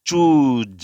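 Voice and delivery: "চুজ" as a loud, drawn-out "chooz"; long U, buzzing Z. Shown as a demonstration. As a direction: stretch "চুজ" and end on a voiced z. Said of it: "যখন production হবে সাউন্ডটা".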